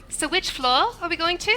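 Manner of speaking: high, playful imitation of a British accent; a friend's British accent, picked up by mistake. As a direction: slip into a British accent without noticing. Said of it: "she's British".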